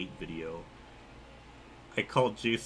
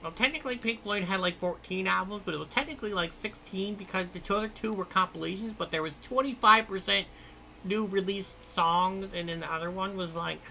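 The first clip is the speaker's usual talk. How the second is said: high, eager know-it-all nerd; nerdy voice; 6 semitones higher than usual. Pretend. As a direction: play the nitpicking nerd, pitched up. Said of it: "it's my nerdy voice".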